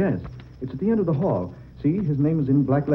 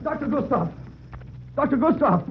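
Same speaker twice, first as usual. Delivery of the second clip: much higher, urgent call; 13 semitones higher than usual. Acted. Reggie calls for the doctor urgently, much higher. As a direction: shout his name, fearing the worst.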